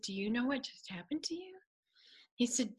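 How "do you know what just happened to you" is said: softer, hushed, higher; hushed question. Retold or imitated. Charlie quietly asking Tess what just happened. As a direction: lean in and ask it gently. Charlie asks gently after the prayer.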